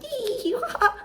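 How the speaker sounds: high silly mock cackle; mock giggle. Mocking the landlords' idea of her, carefree giggle. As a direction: giggle like you don't care at all. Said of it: "just over here being like".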